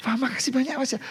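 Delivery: imitating a grateful wife, light and high; happy wife. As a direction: mimic her pleased surprise. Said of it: "Dia tahu".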